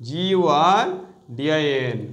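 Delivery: letters of জিইউআরডিআইএন said very slowly; slow spelling out. Shown as a demonstration. Teacher dictating the spelling to students, slow letters. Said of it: "guardian".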